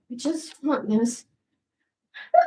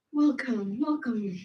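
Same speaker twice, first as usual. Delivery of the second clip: fading welcome; 3 semitones lower, 6 dB quieter, slower than usual. Pretend. Trailing repeated welcome.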